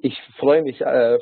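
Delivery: normal talking voice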